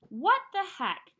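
ordinary delivery, nothing marked

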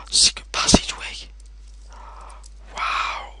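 hushed discovery; awed whisper. Real, not acted. Gamer whispering on finding a secret passage. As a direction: gasp then whisper it.

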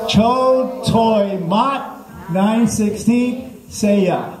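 very slow, high, stretched syllables; mock language-lesson recitation. Shown as a demonstration. Comic leading the crowd's repeat, slow high recitation. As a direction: lead the class word by word, high and drawn out.

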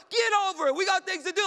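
loud, high mock yell at a sick person; shouting get over it; impatient shout. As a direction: bark it out like you've no patience.

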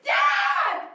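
very high, loud scream. Shown as a demonstration. Director showing how you come in and scream for Dad, full scream. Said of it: "That's how you scream".

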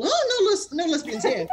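mock scandalised exclamation. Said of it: "the ones that wanna do the whole".

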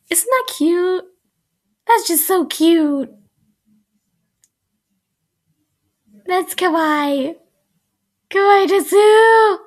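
high, slow, squealy gush; a fan squealing over a kissing scene; squealing over cuteness. Real, not acted. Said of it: "a cheek squishing moment".

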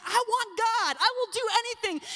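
put-on high, tearful, pleading cry of a weeping girl; crying plea. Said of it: "as she's crying her eyes out".

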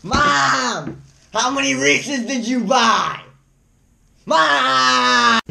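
loud yell to another room; calling out. Real, not acted. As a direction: shout for mom from afar. Kid yelling to mom offscreen.

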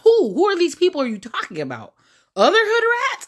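high, sharp disbelieving questions; mock the claim with disbelief; incredulous. Real, not acted.

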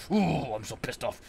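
ordinary delivery

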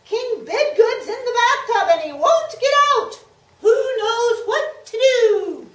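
high, loud stagey page voice; stagey fake archaic declaiming. Page voice in story.

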